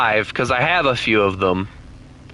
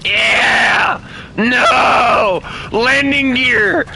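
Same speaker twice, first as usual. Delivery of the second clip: high, loud screaming; frantic shouting; 11 semitones higher, 4 dB louder, much slower than usual. Real, not acted. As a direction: scream every word in panic. Desperate crash landing attempt.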